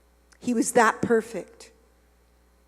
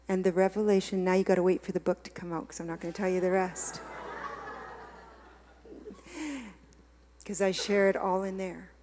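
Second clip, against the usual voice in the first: usual speaking voice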